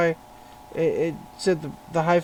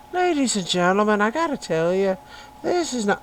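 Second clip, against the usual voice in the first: high, loud Irish brogue; playful, booming Irish voice; Irish accent; 6 semitones higher, 7 dB louder than usual. Pretend. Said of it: "speaking in that voice".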